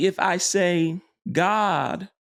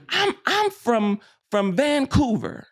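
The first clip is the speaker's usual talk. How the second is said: put-on higher, slow, insistent little kid voice; stubborn child; 9 semitones higher, slower than usual. Pastor voicing a stubborn child who denies being Canadian, higher and slow.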